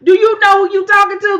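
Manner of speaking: high, loud mocking warning. Vlogger voicing herself warning Toya, loud and high. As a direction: pitch it way up and hit it loud.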